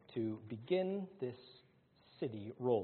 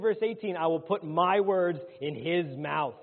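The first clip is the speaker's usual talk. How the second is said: loud, raised-pitch scripture proclamation; forceful preaching; 6 semitones higher, 10 dB louder than usual. Acted. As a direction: preach the verse out with full force. Preacher declaims scripture at full force.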